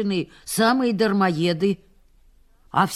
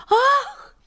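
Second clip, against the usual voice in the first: gasp; 5 dB louder than usual. Retold or imitated. Mistress fainting.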